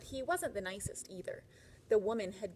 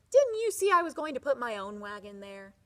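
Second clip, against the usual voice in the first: angry yell; 2 semitones higher, 10 dB louder than usual. Acted. Husband yelling at farmer.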